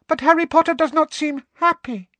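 high, loud squeaky elf. Acted. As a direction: squeak it with concern. Elf voice, timid.

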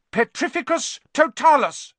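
high, loud spell cry. A narrator's reading. Hermione pointing wand at Neville, crying out. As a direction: cast it with a ringing cry. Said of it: "she cried".

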